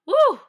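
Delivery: short high loud woo; whoop. Real, not acted. Fan cheering for the actor, high whoop. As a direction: let out a high-pitched whoop.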